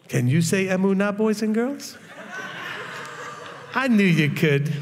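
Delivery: mock kindly children's host reading cue cards, with a laugh; a hammed-up sing-song kids' TV host, teasing; Mister Rogers. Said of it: "they sound like Mister Rogers reading off of cue cards".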